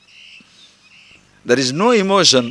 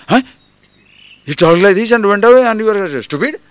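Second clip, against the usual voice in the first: mock outrage; 4 semitones higher, 5 dB louder than usual.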